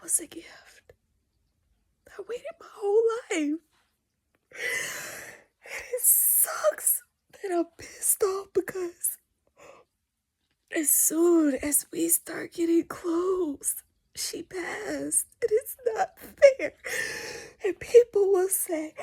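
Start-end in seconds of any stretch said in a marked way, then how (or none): 2.1-9.2 breaking into tears. Real, not acted. Grieving her mother's death. 15.4-18.9 loud, high sob-laden protest; crying out. Real, not acted. To her viewers about her mother's death, loud crying.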